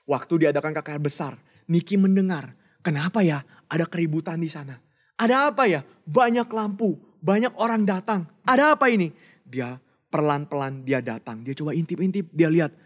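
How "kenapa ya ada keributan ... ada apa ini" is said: curious wonder. Acted. Niki wonders at the crusade.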